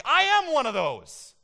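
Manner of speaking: high, loud burst of realization; sudden realization. Retold or imitated. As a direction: blurt it out like a revelation. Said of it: "I was like".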